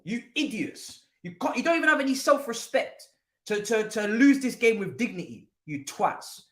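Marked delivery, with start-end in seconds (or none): none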